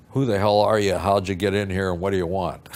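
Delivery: loud, gruff challenge to an intruder; rough boss. Retold or imitated. Big boss challenging a kid who walked in, rough. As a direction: imitate a boss barking at an intruder. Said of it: "he was pretty rough with me".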